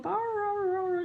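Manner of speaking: a dog begging in howls; dog whining. Retold or imitated. Dog Halo begging mum for biscuit, high whine. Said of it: "she's like".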